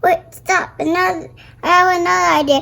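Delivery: usual speaking voice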